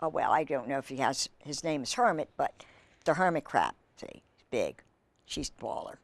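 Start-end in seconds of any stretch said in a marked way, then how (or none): 4.0-6.0 whispered aside. Real, not acted. Aside to child during story.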